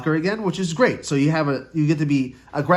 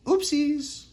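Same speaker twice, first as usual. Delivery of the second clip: cutesy; 13 semitones higher than usual. Real, not acted. Knocks cards, cutesy reaction.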